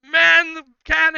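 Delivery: high, loud shouted command; panicked order. Acted. Drunk captain screaming orders at the crew, high and loud.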